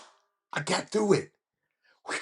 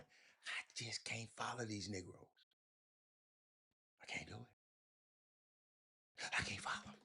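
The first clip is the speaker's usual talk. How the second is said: whispered aside; 5 semitones lower, 23 dB quieter than usual. Real, not acted.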